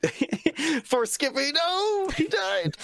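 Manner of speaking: high-pitched laughing cry; helpless laughter breaking into the words; laughing outburst. Real, not acted. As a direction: squeal it through real laughter. Cracking up over the joke.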